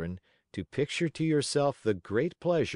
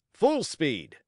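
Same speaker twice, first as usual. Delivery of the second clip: loud, high-pitched ringmaster's order; circus-master's cry; called command; 6 semitones higher, 4 dB louder than usual. A narrator's reading. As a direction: urge the donkey on with a sharp call. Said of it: "again the voice of the manager called".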